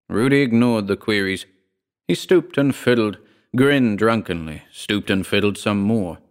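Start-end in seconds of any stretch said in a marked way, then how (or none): none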